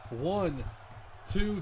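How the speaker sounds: high, rising count of two; excited count. Acted. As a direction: call the near fall. Near fall count.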